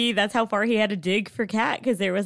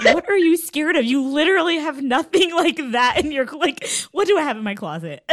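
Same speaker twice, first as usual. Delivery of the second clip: higher and louder, incredulous; mock outraged retort; 9 semitones higher, 6 dB louder than usual. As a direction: fire it back in disbelief. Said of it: "And then I was like".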